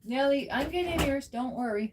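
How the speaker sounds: high, soft soothing pet voice; sweet, softened promise to a pet; reassuring a pet. Real, not acted. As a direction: gently soothe an impatient cat. Reassuring the waiting cat.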